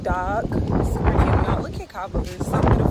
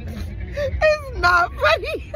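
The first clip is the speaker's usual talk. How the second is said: laughing; much slower than usual. Real, not acted. Stuck in mud, laughing hard.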